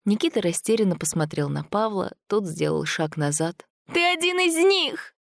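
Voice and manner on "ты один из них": very high, panicked accusation; hysterical cry. A narrator's reading. Sveta accusing Gromov of being a creature, hysterical cry. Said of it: "истерично крикнула Света".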